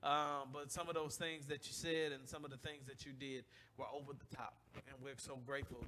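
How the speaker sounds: fighting tears while thanking the church; holding back tears. Real, not acted. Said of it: "I'm not gonna talk about it 'cause I'm gonna cry".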